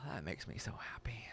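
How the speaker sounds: soft sigh, then whispered; touched whisper. Real, not acted. Host, moved by a viewer's news, whispers softly. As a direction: breathe it out, moved.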